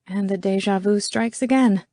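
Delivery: plain reading voice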